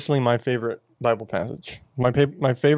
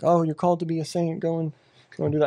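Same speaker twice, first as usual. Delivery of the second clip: dismissive sing-song, higher and a touch softer, tossing off the cliché; mock glib advice; 5 semitones higher, 3 dB quieter, faster than usual. Mocking how people toss around the saying, lightly higher and offhand. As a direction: brush it off like an empty slogan.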